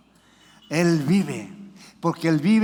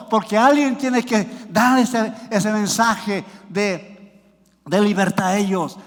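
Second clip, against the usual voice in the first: forceful, urgent exhortation to the congregation; fervent preaching; 3 semitones higher, 4 dB louder than usual. Real, not acted. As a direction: preach it hard, pressing the call home. (exhortación ferviente y enérgica)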